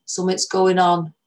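put-on old northern English man warning the girls; northern English accent. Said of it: "He spoke slowly and with an accent from further north".

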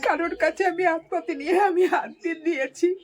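real crying. Real, not acted. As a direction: plead your dignity through tears. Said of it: "আপনি কাঁদবেন না". (কান্নাভেজা গলায়)

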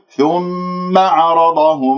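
ثم with a full nasal hum and no stress; no stress on thumma. Shown as a demonstration. Quran teacher models the correct reading for students. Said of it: "لا تنبرها تقول".

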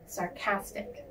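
Sarcastic with the stress on CAS; stress on second syllable. Shown as a demonstration. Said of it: "emphasis is on the second syllable".

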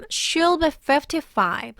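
"fifty-five" lifted and drawn out slowly; slow model sentence, weight on "fifty-five"; stress on fifty-five. Shown as a demonstration. Teacher showing learners the key number, stressed "fifty-five". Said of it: "fifty-five is stressed here".

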